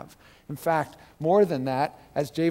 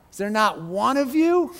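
taunting giant; 7 semitones higher, 5 dB louder than usual. Acted.

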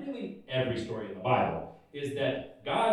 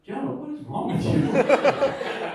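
slow, loud, high exasperated parent through a laugh; an amused parent who can't believe it; laughing, drawn-out loud scolding; laughing exasperation; 9 semitones higher, 8 dB louder, slower than usual. Retold or imitated. God scolding Jonah, laughing.

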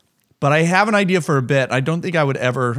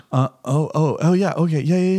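groggy easygoing waking; 2 semitones higher than usual. Retold or imitated. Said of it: "best case scenario, they're like".

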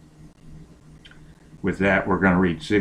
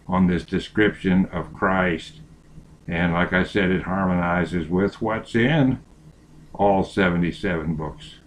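ordinary talk

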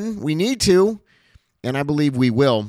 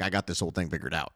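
smug aside; 5 semitones lower, 9 dB quieter, faster than usual. Retold or imitated. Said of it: "I never am gonna look at it and say".

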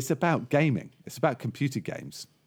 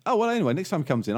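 higher, fast barman; quick, higher landlord voice; 8 semitones higher, 4 dB louder, much faster than usual. Acted. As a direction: rattle it off as the barman.

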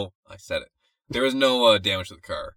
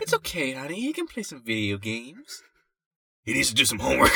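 man doing a woman's voice; 2 semitones lower, 8 dB quieter than usual. Retold or imitated. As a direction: coo it like a gentle mom. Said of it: "What's my mom voice".

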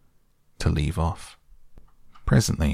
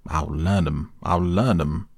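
mildly raised, repeated vow; crying out; slower than usual. A narrator's reading. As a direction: repeat it like a determined threat. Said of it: "he cried".

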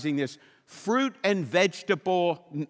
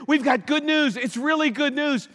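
mock cheery herald; 7 semitones higher than usual. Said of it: "the heralds went out and said".

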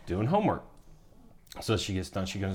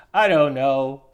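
higher, louder mock kid whine; upset child; 4 semitones higher, 7 dB louder, slower than usual. Father mimicking upset child at dinner, high whine. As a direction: imitate a whiny little girl.

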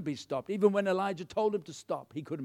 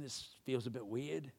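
low, very soft embarrassed mumble; sheepish; 5 semitones lower, 13 dB quieter than usual. Acted. King aware that other people are watching, sheepish. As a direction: say it small and self-conscious. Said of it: "maybe he's feeling a bit sheepish".

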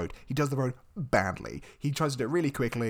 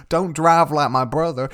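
slow, loud retold shout; retold yell; 8 dB louder, slower than usual. Host quoting Rusty and Dusty, a loud slow yell. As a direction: call it out like the twins. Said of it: "Rusty and Dusty both yell".

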